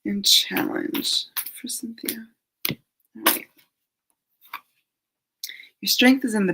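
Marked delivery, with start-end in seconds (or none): 0.0-3.5 whisper. Real, not acted. Whispering the card name.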